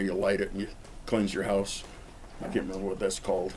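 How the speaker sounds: ordinary delivery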